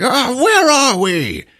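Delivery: high, slow befuddled wizard; dazed wizard voice. Retold or imitated. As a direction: ask it like a lost old wizard. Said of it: "And he's like".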